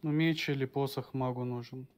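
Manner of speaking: normal talking voice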